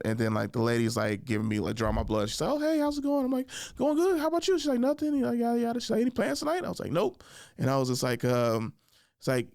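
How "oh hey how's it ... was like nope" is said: lightly raised chatty voices; breezy high retelling of the chat; easy friendly banter voiced up; light mock small talk.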